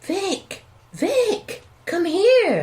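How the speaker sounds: high, loud urgent calls across the house; urgent calling out. Acted. Wife shouting for Vic to come see, urgent call. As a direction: yell for him to come quick.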